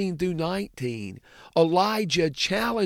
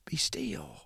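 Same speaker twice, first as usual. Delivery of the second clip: quiet command; 10 dB quieter than usual. Retold or imitated. Jesus commands the storm.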